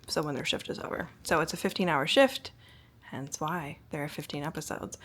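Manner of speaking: ordinary delivery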